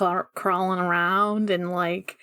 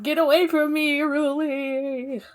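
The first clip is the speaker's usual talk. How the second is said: mock cry, pitched up, name drawn out; higher, hammed-up character cry; imitated warding-off cry; 5 semitones higher than usual. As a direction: act out a pleading warning without full volume. Retold shout of a crazed character.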